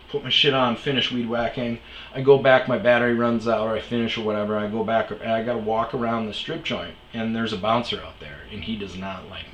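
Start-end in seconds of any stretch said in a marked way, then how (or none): none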